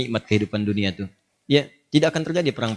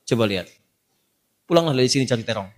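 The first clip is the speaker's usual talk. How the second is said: ordinary talk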